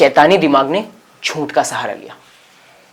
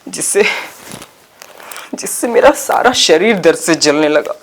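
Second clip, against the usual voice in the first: in pain; 3 semitones higher, 3 dB louder than usual. Acted. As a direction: struggle to speak through the pain. Narrates still hurting from slaps. (दर्द से कराहती आवाज़ में)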